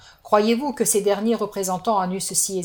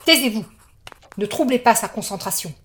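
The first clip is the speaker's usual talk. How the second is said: sharp hush; 13 semitones higher, 7 dB louder than usual. Acted. Sorceress silences dealer.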